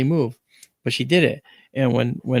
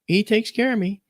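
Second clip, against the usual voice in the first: higher matter-of-fact voice; grandmother's voice; 9 semitones higher than usual. Retold or imitated. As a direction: say it as plain fact. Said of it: "She goes".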